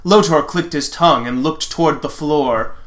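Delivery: normal talking voice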